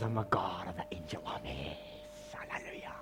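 hushed, intense whisper; Jesus's declaration as a solemn whisper; dramatic whisper. Acted. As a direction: whisper it like a secret threat. Voicing Jesus, whispered again.

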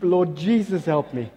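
ordinary delivery

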